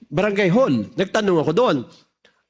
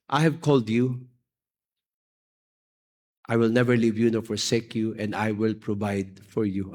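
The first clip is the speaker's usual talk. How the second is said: tinig ng Diyos; 6 semitones lower, 4 dB quieter than usual. Retold or imitated. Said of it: "I heard an audible voice".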